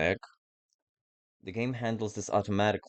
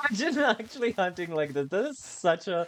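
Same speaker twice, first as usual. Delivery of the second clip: amused; 11 semitones higher than usual. Real, not acted. Amused after laugh.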